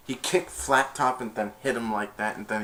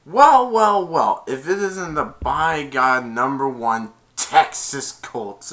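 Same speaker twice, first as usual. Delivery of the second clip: mock sneer; 4 semitones higher, 8 dB louder, slower than usual. Mocking bully greeting rivals.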